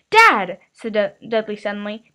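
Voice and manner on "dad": loud sudden call of Dad. A narrator's reading. Son calling his father across the table, loud. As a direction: blurt it out to get attention.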